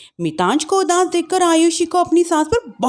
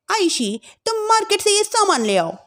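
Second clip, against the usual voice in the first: higher, commanding elder bhabhi; bossy sister-in-law; 8 semitones higher than usual. Acted. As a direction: order her around.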